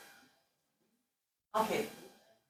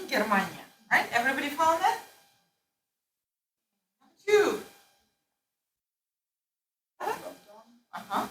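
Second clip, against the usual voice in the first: ordinary talk, nothing marked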